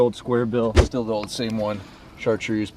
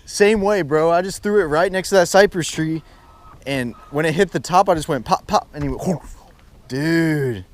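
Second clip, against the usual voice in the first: hyped-up bro talk; frat bro; 7 semitones higher, 5 dB louder than usual. Real, not acted. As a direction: tell it like a pumped bro. Recounting the strike, hyped.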